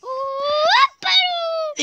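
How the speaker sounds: high, loud, stretched-out whoop; gleeful taunting whoop. Real, not acted. Player who made it teases the fallen one, gleeful whoop. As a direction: whoop it slowly and loudly.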